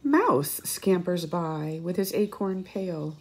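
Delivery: plain reading voice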